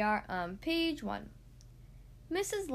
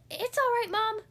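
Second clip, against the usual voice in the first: put-on very high, loud little-boy voice; reassuring child; 10 semitones higher, 5 dB louder than usual. A narrator's reading. Lester looks in after the crash, reassuring. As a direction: pipe up to calm Mom. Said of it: "he reassured her".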